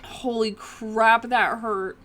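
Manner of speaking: loud, slowed reaction to sharp pain; loud, real pained exclamation. Real, not acted. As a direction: exclaim loudly, just after being hurt. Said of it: "I'm sorry if that was loud".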